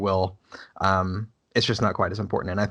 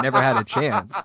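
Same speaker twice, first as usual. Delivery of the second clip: laughing; 4 semitones higher, 5 dB louder than usual. Real, not acted. Laughing, repeating the joke.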